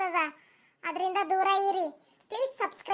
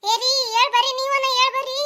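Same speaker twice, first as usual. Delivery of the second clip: higher, louder call for the husband; calling for help; 5 semitones higher, 5 dB louder than usual. Acted. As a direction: yell for him to come and stop it.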